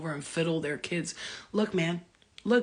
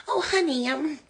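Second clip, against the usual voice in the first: pitched way up, mock sugary "Oh, honey"; sweet honey voice; 8 semitones higher than usual. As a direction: play a syrupy sweet mother, high. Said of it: "We're not like".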